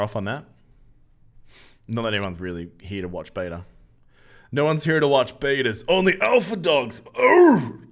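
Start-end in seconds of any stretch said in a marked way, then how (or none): 4.5-7.8 shouted way up high, cracking into a cry; mock alpha yell. Streamer yells a dumb joke at chat, hammed-up cracking shout. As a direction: bellow the alpha dogs joke. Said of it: "I regret doing that".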